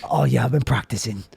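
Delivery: low laid-back action star; low, put-on tough guy; Jason Statham. Star answering a compliment on accent, low and cool. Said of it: "Jason Statham".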